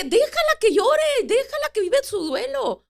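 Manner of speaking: high, loud retold exclamation; emphatic retort. Retelling what she told her husband, loudly insistent. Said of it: "yo nomás dije".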